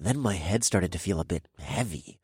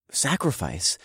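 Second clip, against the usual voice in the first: plain reading voice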